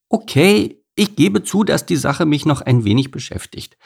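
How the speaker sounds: high-pitched, reluctant admission; mildly grumbling cow; grudging grumble. A narrator's reading. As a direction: concede grudgingly, pitch starting high and coming down. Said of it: "brummte Kuni und senkte den Kopf".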